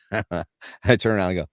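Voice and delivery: normal talking voice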